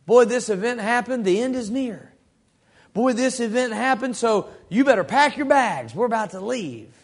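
a touch of a preacher sounding the alarm; lightly voiced preacher warning of the end; alarmist preacher. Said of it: "And they say things like".

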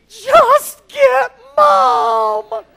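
put-on high, loud, drawn-out child's plea; kids demanding Mom. Father retelling his kids' plea for Mom. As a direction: whine it like a hurt kid wanting Mom.

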